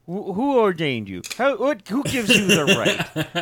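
indignant. Retold or imitated. Indignant retort to blesser.

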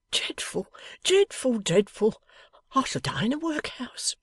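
high, wailing despair; despairing cry. A narrator's reading. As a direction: cry out in ruin. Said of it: "cried the Golden Dustman".

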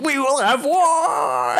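high, slow, hammed-up war cry; mock battle cry. Retelling the paladin's outburst, a hammed-up high war cry. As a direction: bellow it like a crazed zealot. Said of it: "Just screams and runs out".

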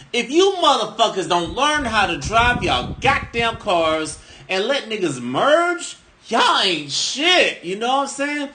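high, slow furious rant at bad drivers. Real, not acted. Ranting at Florida drivers who won't let him merge, high and slow.

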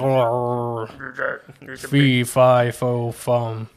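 lightly voiced giant chant; a hint of a lumbering giant; dim giant cyclops. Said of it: "That was my giant impression".

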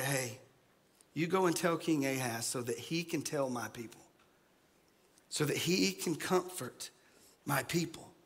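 soft, low, tender reassurance; gentle divine voice. Retold or imitated. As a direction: speak softly as God comforting his prophet. God speaking gently through Isaiah.

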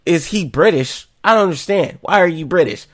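high, loud bewildered questioning; confused. Retold or imitated. Viewer puzzled by the British character, confused. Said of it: "that's why I was so confused".

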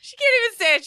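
squealing through laughter; laughing. Real, not acted. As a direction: laugh it out, high-pitched. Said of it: "She just starts laughing".

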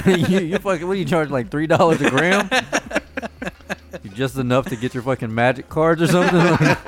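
high, loud talk broken by laughter; can barely get it out for laughing; laughing hard. Real, not acted. Teasing a friend's dealer while laughing high and loud.

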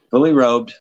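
normal talking voice

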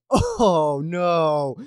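long drawn-out groaned "oh no"; dismayed reaction. Retold or imitated. Football fan reliving the doomed play from his recliner, drawn-out dismay. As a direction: relive a dismayed cry, stretched out.

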